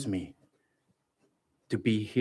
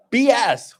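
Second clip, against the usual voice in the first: mock callout; 10 semitones higher, 7 dB louder than usual. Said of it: "I was like".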